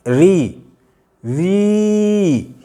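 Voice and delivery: री stretched until only ई remains; prolonged री. Shown as a demonstration. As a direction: hold री long so it fades to ई. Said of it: "अगर री कहते हैं और इसको प्लुत करके बोल के देखेंगे". (री को प्लुत करके खींचें)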